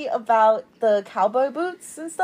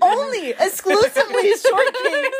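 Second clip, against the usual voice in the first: high, slow, laughing through the words; giggling exasperation; 11 semitones higher, 5 dB louder than usual. Real, not acted. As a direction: laugh while you say it. Laughing about mom's matchmaking.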